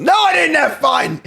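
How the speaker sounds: high, loud, laughing protest; laughing outburst. Real, not acted. Streamer laughing off his loss to chat, loud and high. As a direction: yell it through laughter. Laughing rant after losing.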